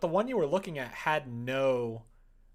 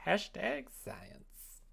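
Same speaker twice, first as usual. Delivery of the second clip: mock hashtag; 7 semitones higher, 3 dB quieter, much slower than usual. Joking tag on useless results.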